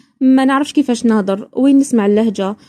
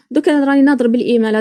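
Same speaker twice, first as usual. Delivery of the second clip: ordinary talk, nothing marked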